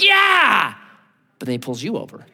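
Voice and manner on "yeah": high, loud cheer of triumph; gleeful shout. Retold or imitated. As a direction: cheer as the police show up. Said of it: "you're like".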